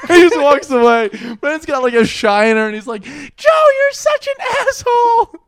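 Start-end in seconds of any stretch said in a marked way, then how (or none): 3.0-5.4 loud, high squeaky complaint; Brendan. Acted. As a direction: whine it angrily in a mock high voice. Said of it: "his voice is high like this".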